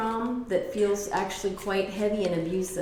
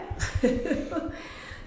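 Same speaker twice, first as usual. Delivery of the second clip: high breathy laugh; 8 semitones higher, 5 dB quieter than usual. Real, not acted. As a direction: giggle. Laughs after spirit voice.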